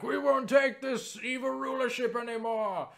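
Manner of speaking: high, rousing rebel cry; rally the crowd against the tyrant; rallying peasant. Retold or imitated. Peasant rebels rally against the evil witch with a high cry.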